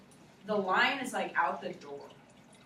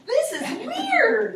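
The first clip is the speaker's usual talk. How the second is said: slow, high and louder, laughing through it; drawn out in amused disbelief; weirded out; 13 semitones higher, 7 dB louder, much slower than usual. Retold or imitated. Teacher retelling the strange night, high slow laughing disbelief.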